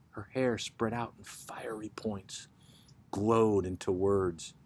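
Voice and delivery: breathy whisper, voice dropping away; hushed whisper. Acted. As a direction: let the words sink into a whisper. Hushed turn in the poem.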